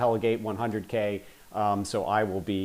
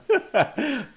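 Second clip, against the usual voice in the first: high burst of laughter; 3 dB louder than usual. Real, not acted. Laughs at own chaos.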